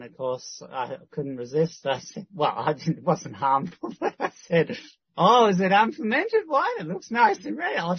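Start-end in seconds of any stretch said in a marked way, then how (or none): 5.1-7.8 high, teasing fake-innocent question; mock-innocent teasing, higher. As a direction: play dumb to tease her, pitched up. Teasing question about her wine.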